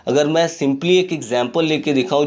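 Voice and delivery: normal talking voice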